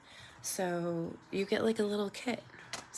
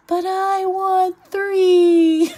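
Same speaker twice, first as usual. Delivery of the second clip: put-on much higher, louder, slow drawn-out child whine; imitating toddler's demand; 13 semitones higher, 16 dB louder, much slower than usual. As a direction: stretch it out like a greedy little kid. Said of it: "And then she goes".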